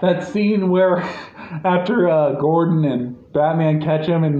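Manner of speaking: usual speaking voice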